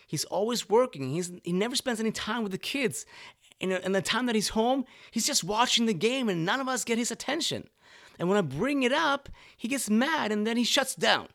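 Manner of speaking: high-pitched, exasperated wife's complaint; complaining wife. Retold or imitated. Wife venting to the counselor about her husband, in a high, exasperated whine. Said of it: "And she would say something like".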